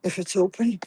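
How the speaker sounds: hushed, quick whisper. Real, not acted. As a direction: keep it to a whisper. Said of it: "I have to whisper again".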